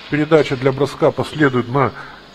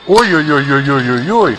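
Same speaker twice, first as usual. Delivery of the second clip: alarmed oh-oh-oh; 5 dB louder than usual. Real, not acted. Dangerous chance at goal.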